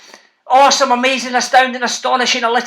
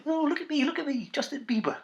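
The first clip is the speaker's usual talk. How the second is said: mock vain preening, much softer; 4 semitones higher, 14 dB quieter than usual. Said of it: "[name] thinks he looks like [name]".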